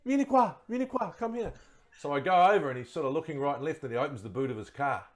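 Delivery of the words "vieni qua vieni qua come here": Italian man calling. Retold or imitated. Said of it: "he says".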